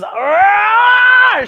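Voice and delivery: high loud shouted monster roar; playful roar. Acted. Buffy roaring at the monsters, loud playful shout. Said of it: "And Buffy says".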